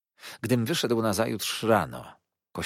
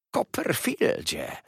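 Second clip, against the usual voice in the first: slow, higher hiss of a sly clerk; hissed name; 6 semitones higher, slower than usual. A narrator's reading. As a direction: hiss the name through the teeth. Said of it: "syknął wreszcie". (syknięcie przez zęby)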